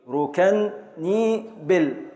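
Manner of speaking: ركنيبل spoken very slowly, syllable by syllable; slow scansion. Shown as a demonstration. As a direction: drag out ركنيبل to show the beats. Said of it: "من أول الراء". (تقطيع بطيء جدا)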